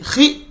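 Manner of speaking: खी snapped out high and abrupt; mock jerked khee. Shown as a demonstration. Teacher demonstrates the wrong jerky खी, high. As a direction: jerk खी out sharply to show the fault. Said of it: "झटके के साथ पढ़ना गलत है".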